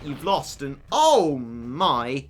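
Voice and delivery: ordinary delivery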